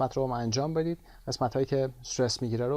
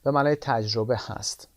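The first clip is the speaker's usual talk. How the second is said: ordinary talk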